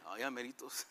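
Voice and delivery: ordinary talk, nothing marked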